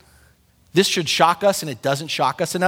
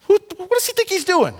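much higher, outraged East Coast grumble; East Coast style; 12 semitones higher than usual. Retold or imitated. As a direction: shoot it up in indignant disbelief. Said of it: "East Coast style".

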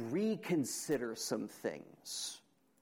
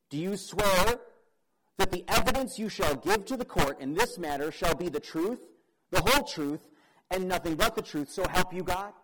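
recited courtroom swearing-in, raised and firm; courtroom oath; 4 semitones higher, 7 dB louder than usual. Acted. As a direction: put the witness under oath, louder. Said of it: "The oath to testify".

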